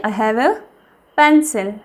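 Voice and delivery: normal talking voice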